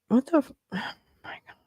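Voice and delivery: fast whispered curse under a sigh; exasperated whisper. Real, not acted. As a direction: breathe it out in frustration. Frustrated at computer.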